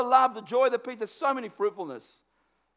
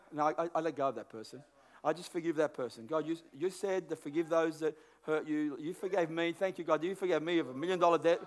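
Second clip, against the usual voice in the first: quiet forgiving prayer; 6 semitones lower, 3 dB quieter than usual. Retold or imitated.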